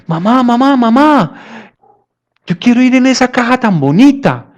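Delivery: put-on high little-boy voice tugging at his mother; small child pleading. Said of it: "el niño le jala la mano a la mamá y le dice". (niño pequeño suplicando con voz aguda)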